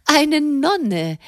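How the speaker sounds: high astonished exclamation; a lady amazed it's a nun. Acted. Madame astonished that the letter comes from a nun. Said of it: "rief Madame erstaunt".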